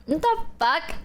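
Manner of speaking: ordinary talk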